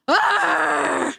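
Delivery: mock wrestling yell. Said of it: "and shout".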